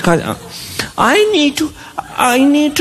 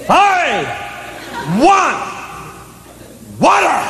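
yelling for water; 9 semitones higher, 4 dB quieter than usual. Retold or imitated. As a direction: call out from bed like a thirsty kid. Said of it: "And then she yells".

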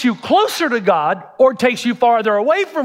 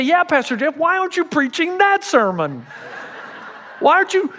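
mock heckler; 7 semitones higher than usual. Imagined congregant challenging pastor.

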